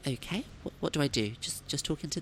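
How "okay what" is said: usual speaking voice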